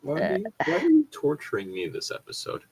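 usual speaking voice

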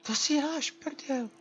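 high squeaky disbelieving outburst; outraged disbelief. Real, not acted. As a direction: yelp it in indignant shock. Outraged at game result. (pobouřený vysoký výkřik)

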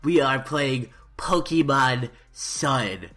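over-the-top Let's Play host; YouTuber intro. Acted. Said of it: "YouTuber intro voice".

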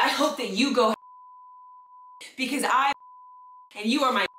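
chopped phrases with gaps as if bleeped; bleeped-out demo. Shown as a demonstration. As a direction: leave silent holes where words vanish. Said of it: "You can just be like".

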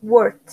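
WORKED ending in a T; ED of WORKED pronounced as T; final T sound. Shown as a demonstration. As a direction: say WORKED as one syllable, "workt". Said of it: "es como una T al final".

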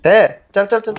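urgent urging. Real, not acted. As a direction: hurry them along, urgently. Urging teammate in firefight.

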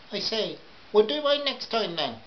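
high, put-on customer voice; high-pitched imperious customer; bossy housewife. A narrator's reading.

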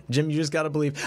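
usual speaking voice

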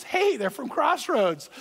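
high, slow "Hey" call of recognition; mock excited call. Speaker spotting a church sticker, excited call. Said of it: "He goes".

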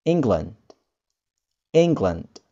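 England said ING-glund, E as I; England with an I sound at the front; English England. Shown as a demonstration. Teacher models England, E sounding as I. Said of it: "E-nya di depan berubah menjadi I".

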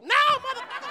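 high, loud mock outrage; exasperated high-pitched protest; indignant retort. Indignant retort to being misrecognised.

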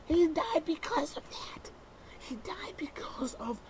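high, hissed whisper; slow fervent stage whisper; intense whisper. Acted.

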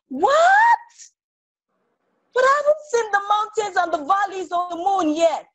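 squeaky high cry of disbelief; shocked little sister. Acted. Little sister protesting to her brother, high shocked cry. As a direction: jump way up in pitch, outraged.

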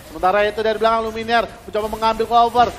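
ordinary talk

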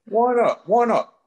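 loud, high, indignant why not; real sharp protest, loud; indignant outburst. Real, not acted. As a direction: fire it back loud and high. Defensive retort to cohost doubt.

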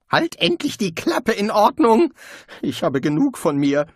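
furious high shout; exasperated outburst. Acted. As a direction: blow up at the nagging voice. Rincewind snaps at conscience.

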